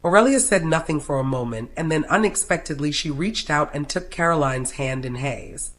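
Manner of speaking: ordinary delivery, nothing marked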